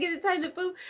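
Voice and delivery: put-on high child voice breaking into laughter; cutesy kid voice, half laughing; laughing toddler voice. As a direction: squeak it like a little kid through giggles. Still voicing the daughter.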